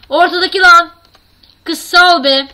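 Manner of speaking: loud, high excited call; excited shout. Real, not acted. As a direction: yell it out with delight. Oyunda kıza seslenen heyecan. (heyecanlı bağırış)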